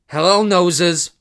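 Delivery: high, loud, slow monstrous yell; hideous yell. A narrator's reading. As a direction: leap out with a horrible taunt. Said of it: "a hideous voice yelled".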